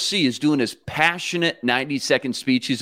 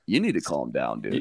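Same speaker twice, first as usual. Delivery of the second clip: much lower, softer calm coach voice; deadpan coach voice; 14 semitones lower, 7 dB quieter than usual. Retold or imitated. Friend imitating the coach telling Kirk to calm down, low and soft. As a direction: drop it low and flat like an unbothered coach. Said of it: "K O C just goes".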